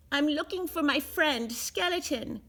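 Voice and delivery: a small hopeful goblin asking. A narrator's reading. Goblin voiced in story.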